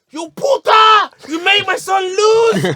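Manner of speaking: high, loud, slow mock shout at the ref; a hammed-up angry father yelling; furious retold yell. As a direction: bellow it like an outraged dad.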